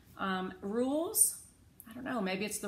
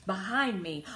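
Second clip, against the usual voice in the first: usual speaking voice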